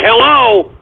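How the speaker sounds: a puzzled hello into the mic; calling out unsure. Real, not acted. Host testing his glitching mic, puzzled hello. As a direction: check whether anyone can hear.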